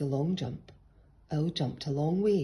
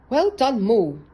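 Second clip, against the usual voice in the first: loud, high praise; crying out; 7 semitones higher, 11 dB louder than usual. A narrator's reading. Miss Hoot loudly praising Mole at the finish. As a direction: cry out in delight. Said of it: "cried Miss Hoot".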